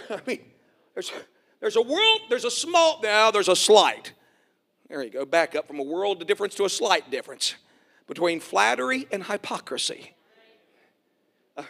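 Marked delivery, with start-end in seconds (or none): none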